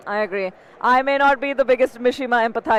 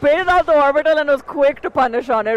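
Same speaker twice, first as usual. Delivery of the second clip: hyped, higher and louder play call; excited; 5 semitones higher, 4 dB louder than usual. Real, not acted. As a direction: shout out the big play. Hyped commentary on a big play.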